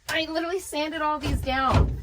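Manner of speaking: high-pitched protest; exasperated. Real, not acted. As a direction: complain in a high, pained voice. Build failing after hard work.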